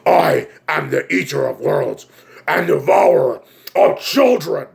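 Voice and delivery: slow booming monster proclamation; Pennywise. Acted. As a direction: declare it slowly like a giant evil creature. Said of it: "He's like".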